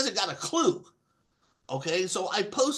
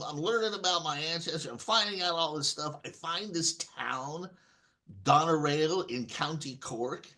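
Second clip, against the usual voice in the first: normal talking voice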